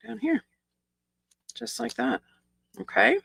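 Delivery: ordinary talk, nothing marked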